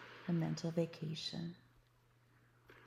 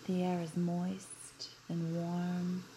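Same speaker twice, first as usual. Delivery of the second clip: guided meditation. Acted. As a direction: guide them gently into relaxation. Leads a guided beach visualization.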